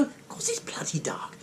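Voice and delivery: hushed whisper of a blunt reply; a quiet unvoiced aside. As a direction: whisper it as a deadpan retort. Girl's retold reply.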